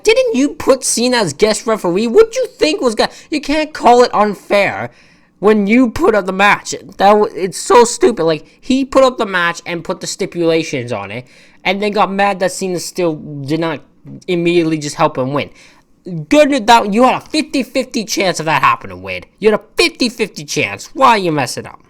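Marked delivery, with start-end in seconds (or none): 0.0-6.7 high, incredulous mock retort to Wade. Vlogger mocking Wade's complaint, high incredulous scoff. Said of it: "but I'm like". 16.3-21.8 mock scolding. Scolding Wade directly.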